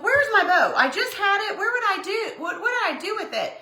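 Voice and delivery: high-pitched, puzzled self-questioning; mock searching. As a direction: act out hunting for a lost thing. Acts out searching for bow.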